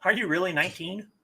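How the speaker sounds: usual speaking voice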